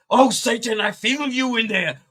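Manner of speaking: high, loud dramatic casting out; exorcist priest. Retold or imitated. Priest casting Satan out of a parishioner, loud and high. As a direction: command the demon theatrically. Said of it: "he instantly is like".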